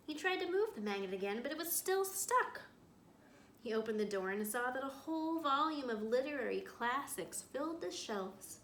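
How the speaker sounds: reading a story aloud to a class; storyteller voice for young listeners; picture book read-aloud. A narrator's reading. Teacher reads picture book aloud.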